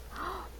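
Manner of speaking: sharp, high gasp of delight; excited gasp. Retold or imitated. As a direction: gasp like a thrilled cashier. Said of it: "And she's like".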